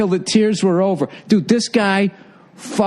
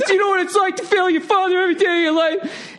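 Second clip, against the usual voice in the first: very high, fast, tearful mimicry; hammed-up wail of an emotional owner; mock crying outburst; faster than usual. Owner breaks down at Gordon, hammed-up tearful shriek. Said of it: "He starts crying".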